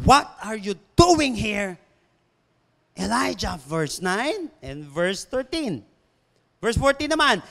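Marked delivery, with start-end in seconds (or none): 0.1-3.6 "What are you doing here" slowed and weighted, pause before "Elijah"; emphatic repetition. Shown as a demonstration. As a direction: stretch "doing here" with weight, then set "Elijah" apart. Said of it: "ng emphasis".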